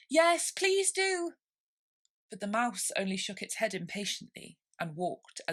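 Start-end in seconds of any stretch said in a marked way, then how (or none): 0.1-1.4 high, loud, slow; drawn-out pleading chorus; chorus plea. A narrator's reading. Birds calling after the mouse, slow loud chorus. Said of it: "joined in chorus".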